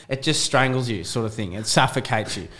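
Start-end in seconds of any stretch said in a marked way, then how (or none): none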